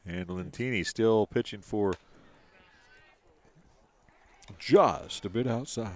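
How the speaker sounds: a mild hint of an old-time ballgame caller; Bob Uecker imitation. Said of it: "My best Bob Uecker imitation".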